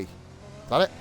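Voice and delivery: usual speaking voice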